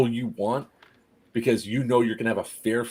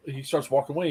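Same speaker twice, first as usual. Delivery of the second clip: ordinary delivery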